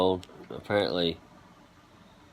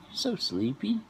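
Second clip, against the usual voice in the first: so sleepy asked much higher, rising; squeaky pet talk; 12 semitones higher, 3 dB quieter than usual. Real, not acted. Asking his sleepy dog, high squeaky baby talk.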